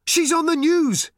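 high, loud, slow excited shout. A narrator's reading. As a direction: call out from the next room, thrilled. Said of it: "shouted Arthur".

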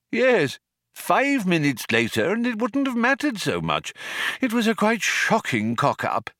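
put-on high-pitched, fussy elderly craftsman; old planet-builder, put out. A narrator's reading. Old man lamenting Earth's destruction to visitor, put out.

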